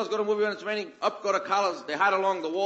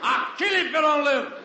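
frantic threat; 7 semitones higher, 3 dB louder than usual. Acted. Melvin threatening the screen.

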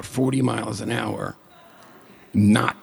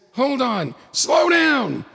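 much higher, rising to a yell; panicked shout; 12 semitones higher, slower than usual. Retold or imitated. Co-driver panicking at the speed, high alarmed shout. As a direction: yell at him to slow down.

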